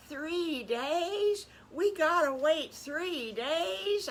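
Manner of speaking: put-on high, drawn-out child whine; dismayed piglet. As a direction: protest the wait in disbelief.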